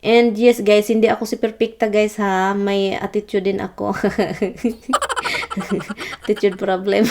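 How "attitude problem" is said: amused self-teasing through laughter; laughing. Real, not acted. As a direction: laugh while owning up to it. Laughs at own admission of attitude. (natatawa sa sarili)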